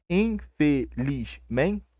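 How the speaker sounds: very slow, each syllable separated; drawn-out demonstration of syllable division. Shown as a demonstration. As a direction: break the word into its syllables. Teacher splits word into syllables.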